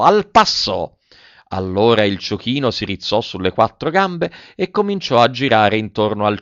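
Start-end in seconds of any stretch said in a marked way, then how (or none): 0.0-1.0 high barked circus order; shouted command. Acted. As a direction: call the pace to the donkey. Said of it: "non gli gridò". (comando gridato dal direttore)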